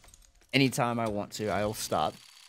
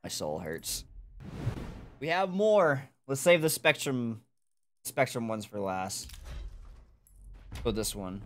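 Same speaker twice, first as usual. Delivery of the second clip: normal talking voice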